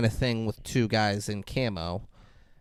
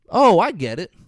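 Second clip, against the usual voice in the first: high, louder sudden realization turned insult; mock exasperation; 6 semitones higher, 5 dB louder than usual. Annoyed shooter answering a clueless questioner, mock exasperated. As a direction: act out a fed-up retort, pitched up. Acted exasperated retort in film.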